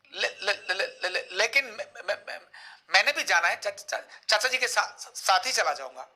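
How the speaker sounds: repeating first syllables, broken start; stuttering. Shown as a demonstration. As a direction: stammer on every first sound. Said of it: "तुतलाना और हकलाना".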